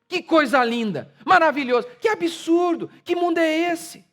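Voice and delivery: rising to high shouted mock praise; mock applauding crowd. Preacher mimics the crowd cheering the athlete, ending in a shout. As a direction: gush like a cheering crowd, then explode. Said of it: "todo mundo diz".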